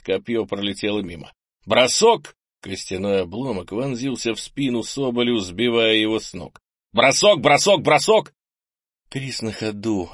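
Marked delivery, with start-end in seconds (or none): 1.6-2.4 loud burst, pitched up at the end; battle shout. A narrator's reading. Narrator calls the next throw in a fight, shouted. 6.9-8.3 loud, high volley of shouts; rapid battle shouts. A narrator's reading. Narrator calls a volley of throws, shouted.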